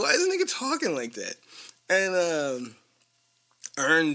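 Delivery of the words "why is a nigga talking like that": high, fast, baffled quoting himself; incredulous quote. Retold or imitated. Retelling his own baffled reaction to the kid, high and fast. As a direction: squeak it out in disbelief. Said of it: "I'm like".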